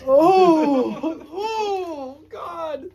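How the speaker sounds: high frightened whimper; a scared, high oh God; spooked. Real, not acted. Spooked by an odd sound.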